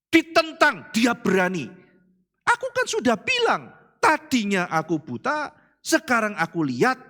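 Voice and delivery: loud, high bold cry; bold preaching. Acted. Blind man defying opponents, loud high preaching. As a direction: declare it without fear.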